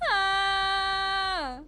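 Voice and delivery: high, loud, held scream; Steagle scream. Acted. Streamer showing off character scream, high held scream. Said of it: "do the Steagle scream so good".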